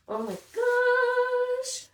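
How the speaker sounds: very high, drawn-out coo; overcome with delight. Real, not acted. Unboxer touched by a note, high delighted coo. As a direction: stretch gosh into a high, singing squeal.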